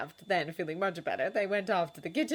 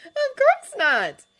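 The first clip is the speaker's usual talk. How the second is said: much higher, loud, slow delighted cry; giggly girls; 13 dB louder, much slower than usual. A narrator's reading. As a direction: squeal a happy yes. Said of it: "The girls giggled".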